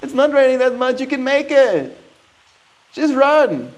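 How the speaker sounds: high coaxing pet voice; coaxing the cat. Real, not acted. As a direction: urge the kitty on up high.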